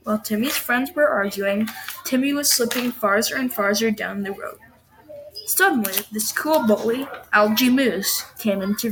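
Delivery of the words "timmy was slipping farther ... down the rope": usual speaking voice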